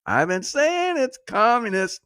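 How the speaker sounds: high, drawn-out sing-song crowing; high-pitched, slow proclaiming; mocking call-out. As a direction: stretch it out high like a gleeful told-you-so. Mocking sing-out of communism charge.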